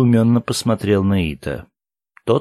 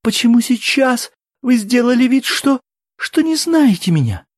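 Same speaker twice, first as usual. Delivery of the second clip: very high, louder tearful wail; crying; 5 dB louder than usual. A narrator's reading. Woman accuses men of pretending not to know her, crying. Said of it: "Она снова заплакала".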